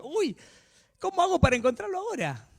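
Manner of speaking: agudo, fingiendo desconcierto; hacé de un Dios confundido en broma; Dios burlón desconcertado. Retold or imitated. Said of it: "Como si eso fuera que diga Dios". (Dios fingiendo no encontrar al que lo bloqueó, en burla)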